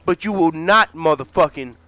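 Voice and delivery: firm pushback. Acted. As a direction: warn him not to come at you.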